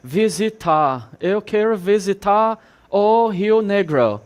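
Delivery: mock foreign accent mangling Portuguese; foreigner's broken Portuguese. Teacher mimicking a foreign tourist, mock foreign accent. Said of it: "ele fala tudo errado".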